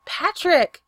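loud, high call of his name; yelling after him. A narrator's reading. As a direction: shout after him as he walks off. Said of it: "she yelled after him".

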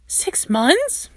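can't believe what he just heard; aghast. A narrator's reading. Stiles hearing they've dated six months, aghast and high. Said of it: "Stiles splutters aghast".

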